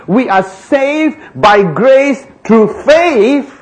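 slow, lifted quote with "faith" rising at the end; stress on faith. Shown as a demonstration. Preacher reading scripture slowly, stressing faith. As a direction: read it slowly, leaning into "faith". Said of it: "So here he's saying".

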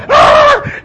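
short high shriek; mock scream. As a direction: shriek like panicked men on a boat. Disciples screaming in the storm.

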